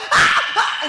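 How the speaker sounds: loud high screaming cries; mock screams. Dying auntie screaming, loud. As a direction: scream in terror. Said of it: "she was screaming".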